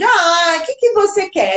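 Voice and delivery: put-on playful reply to a baby; adult answering baby. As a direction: coo back at a babbling infant. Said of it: "O adulto responde".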